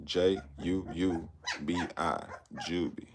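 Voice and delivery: J U U B I spelt out slowly, then Juby said softly; spelling the name. Shown as a demonstration. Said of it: "Her name".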